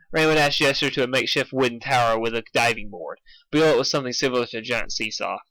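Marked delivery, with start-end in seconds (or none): none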